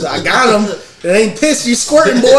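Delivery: high, loud laughing mock taunt; teasing friend. Friend taunting him for wetting himself, laughing mock taunt. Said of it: "He's like".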